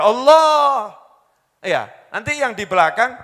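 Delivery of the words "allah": loud, long call of Allah; a drawn-out cry of the name; shouted Allah. Retold or imitated. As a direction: call out to God from the driver's seat. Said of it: "teriak tuh".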